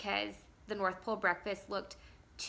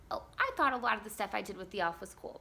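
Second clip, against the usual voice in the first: normal talking voice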